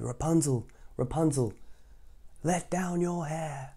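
slow calling up; prince calling. Acted. Prince calling up to Rapunzel from below. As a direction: call up to her gently.

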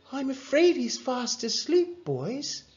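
lifted, regretful parent; apologetic mum. A narrator's reading. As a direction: gently turn the visitors away. Mum turns boys away.

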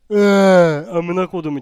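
sudden loud yelp of fright; startled scream. Real, not acted. Gamer jolted by a jumpscare, startled yelp. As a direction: jolt out a scared cry.

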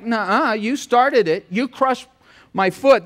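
donkey talking back. Acted. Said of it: "The donkey says back".

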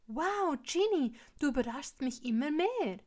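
delighted amazement; impressed. A narrator's reading. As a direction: marvel at a friend's achievement. Impressed by a friend's cake.

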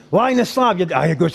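ordinary talk, nothing marked

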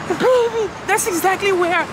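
high, thrilled and breathless; excited. Real, not acted. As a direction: gush with real joy. Overjoyed at Paris reveal.

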